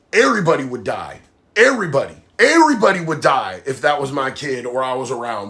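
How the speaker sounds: usual speaking voice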